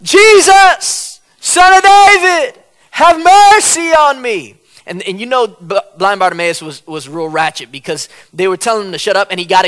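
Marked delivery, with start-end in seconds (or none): 0.0-4.6 high, loud, drawn-out plea for mercy; crying out. Retold or imitated. Blind man begging Jesus for mercy, loud and pleading. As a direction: call out to Jesus, slow and desperate. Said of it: "Bartimaeus cried out".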